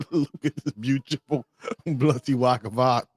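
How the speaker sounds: mock British accent through laughter. Streamer repeating the meme line, laughing in a British accent. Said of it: "British people be like".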